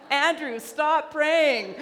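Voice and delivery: laughing, pleading cry to a friend to stop praying; mock exasperated shout. Preacher recalling her seatmate, mock-shouts at Andrew. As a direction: throw it out high and loud, half-laughing. Joking plea on a chatty flight.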